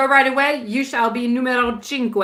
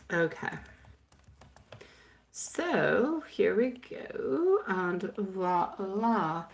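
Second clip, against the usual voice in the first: much softer, murmured reading; very quiet; 9 dB quieter than usual. Real, not acted. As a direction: keep it hushed, almost to yourself. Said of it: "I shall be, oh, very, yes, very, um, silenzioso".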